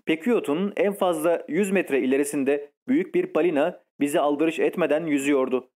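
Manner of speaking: ordinary delivery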